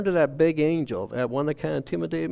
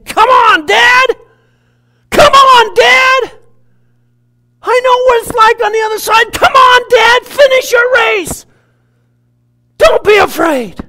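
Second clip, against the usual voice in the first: fervent shout; 18 dB louder, slower than usual. Retold or imitated. Son calling from heaven.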